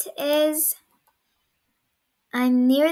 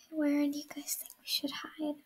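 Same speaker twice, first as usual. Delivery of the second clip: whispering; 11 dB quieter than usual. Real, not acted. Hiding from seeker.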